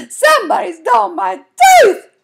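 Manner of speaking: high, loud wail; sobbed. A narrator's reading. Girl wailing to her family about her tooth, sobbing. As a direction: wail it out like a kid in tears. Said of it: "I sobbed".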